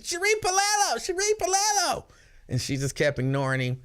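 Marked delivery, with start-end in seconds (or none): 0.0-2.0 very high, squealing mock yell of the name; schoolgirl yell. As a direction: shriek the name like an excited little schoolgirl. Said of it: "he just kept screaming".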